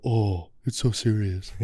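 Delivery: slow, drawn-out mock concern. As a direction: imitate a coach teasing you with overdone gravity. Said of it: "mock me".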